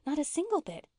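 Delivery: high-pitched, eager insistence. A narrator's reading. Una assures Mary that Nan isn't proud, eagerly. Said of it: "said Una eagerly".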